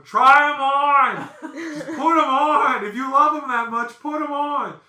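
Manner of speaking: high, loud mock heckle; wife's heckling yell. Wife in the back daring them, high heckling shout. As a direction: holler it from the back, drawn out at first. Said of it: "is in the back going".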